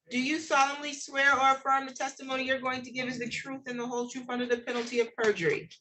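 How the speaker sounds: ordinary talk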